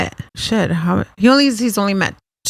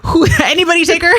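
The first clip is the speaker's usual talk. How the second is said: laughing dad impression; 7 semitones higher, 3 dB louder, faster than usual.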